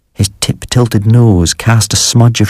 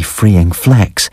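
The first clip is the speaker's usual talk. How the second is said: ordinary delivery, nothing marked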